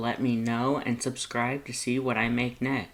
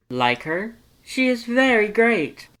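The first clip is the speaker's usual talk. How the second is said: loud, high, excited skeleton voice; booming cheerful Papyrus; 10 semitones higher, 6 dB louder than usual. Acted. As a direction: boom it out, eager and proud.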